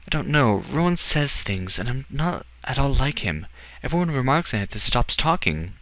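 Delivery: put-on high, defensive boy's voice; young Edward, troubled. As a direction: confess it uneasily in a high boy's voice.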